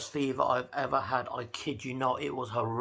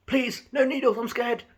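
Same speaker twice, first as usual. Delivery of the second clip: higher pleading mock voice; mock scared plea; 8 semitones higher than usual. Doctor mocking the patient's fear of needles, high mock plea. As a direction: mimic a frightened patient. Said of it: "looked at me like I'm gonna shoot him".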